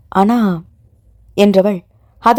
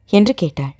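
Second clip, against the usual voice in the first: usual speaking voice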